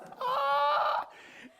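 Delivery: loud, shrieking mock laugh; high wailing laugh. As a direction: trail the outburst off in a high wail. Mock reaction to group's answer.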